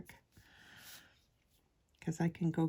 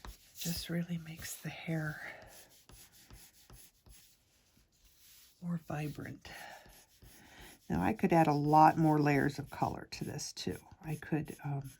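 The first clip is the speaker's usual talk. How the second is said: ASMR whisper. Real, not acted.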